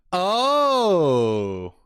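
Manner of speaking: loud, high "oh" that swoops down; real burst of surprised delight; surprised gasp. Real, not acted. Unexpectedly spots Emily on screen.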